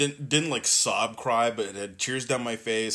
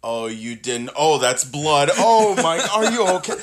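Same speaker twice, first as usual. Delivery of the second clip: mock alarm; 6 semitones higher, 11 dB louder than usual. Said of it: "and you're just like".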